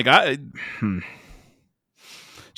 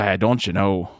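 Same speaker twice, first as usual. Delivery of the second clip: put-on Minnesotan accent; 5 semitones lower than usual.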